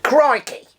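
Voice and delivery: hammed-up Australian crocodile-hunter impression; bad Australian impression, big catchphrase; Steve Irwin. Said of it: "the late crocodile hunter and his signature catchphrase".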